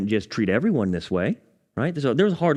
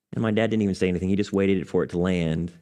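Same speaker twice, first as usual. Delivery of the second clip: usual speaking voice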